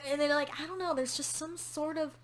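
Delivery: ordinary delivery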